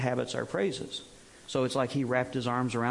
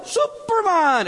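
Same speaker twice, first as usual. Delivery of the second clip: high, loud, drawn-out Superman; excited shout; 8 dB louder, much slower than usual. Retold or imitated. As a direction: call it out like the whole crowd knows. Audience shouting the answer.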